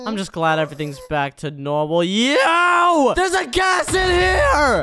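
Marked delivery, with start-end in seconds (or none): 2.0-4.8 high, drawn-out alarmed yell; alarmed shout. Acted. Warning friends of gas in here, alarmed shout.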